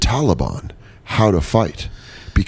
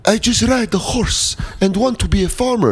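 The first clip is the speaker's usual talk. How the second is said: very high mock villager voice; humble Afghan villager; 12 semitones higher than usual. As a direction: plead in a high put-on voice. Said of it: "And they're like".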